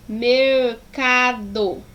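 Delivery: "mercado" slowly split into three syllables; each syllable of "mercado" held and separated. Shown as a demonstration. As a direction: pull "mercado" apart, mer, ca, do. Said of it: "abri a boca três vezes para pronunciar".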